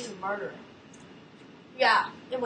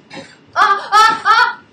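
startled scream; 8 semitones higher, 15 dB louder, much slower than usual. Real, not acted. Startled in game.

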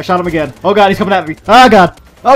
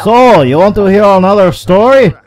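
slow, louder mock storyteller; Mister Story Guy; 3 semitones higher, 5 dB louder than usual. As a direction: drag it out like a pushy storyteller. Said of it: "Mister Story Guy".

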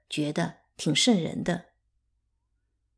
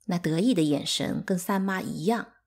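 usual speaking voice

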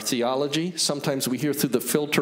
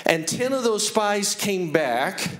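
emphatic preaching; 8 semitones higher than usual. Real, not acted. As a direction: preach it with raised pitch and weighty pauses. Preaches with high, emphatic pitch.

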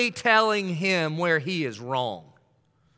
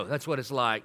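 usual speaking voice

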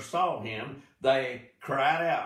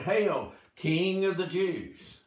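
mocking hail; 6 semitones higher, slower than usual. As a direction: jeer it like soldiers. Soldiers mocking Jesus.

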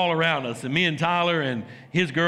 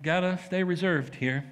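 mock stiff reserve; 5 dB quieter than usual. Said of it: "we're like".

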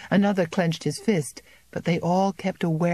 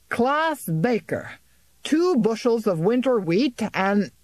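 slow, higher official roll call; bailiff calling out; 6 semitones higher than usual. A narrator's reading. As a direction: read out the name like a bailiff.

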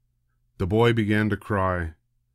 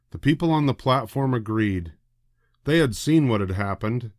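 plain reading voice

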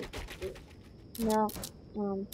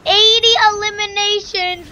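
excited shout; 9 semitones higher, 9 dB louder than usual. Real, not acted. Celebrating a big kill count.

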